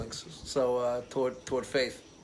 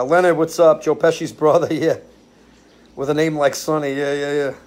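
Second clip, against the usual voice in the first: ordinary talk, nothing marked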